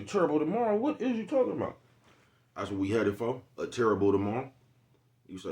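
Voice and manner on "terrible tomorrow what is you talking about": high incredulous voice; high, disbelieving retort; mock confused listener. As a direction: play the baffled guy, pitched up.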